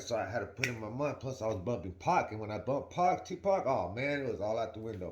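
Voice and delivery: ordinary talk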